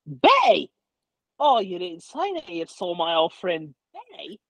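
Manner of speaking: put-on light up at news of an old friend; delighted surprise. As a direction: Tarak hears his old friend Betty is well, thrilled. Tarak delighted to hear of Betty.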